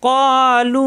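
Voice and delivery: long, drawn-out chanted recitation; recited slowly. Shown as a demonstration. Teacher demonstrating the recitation, slow chant. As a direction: stretch it out in tajweed chant. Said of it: "Quran recitation demo".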